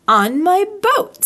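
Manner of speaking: proud. Acted. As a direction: puff up with pride at the boat. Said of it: "said Pooh proudly".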